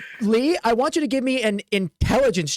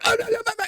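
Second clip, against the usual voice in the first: high, repeated mock wail; distraught whimpering; much faster than usual. Orc forced to saw despite his grief, wailing. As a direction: whimper high while giving in.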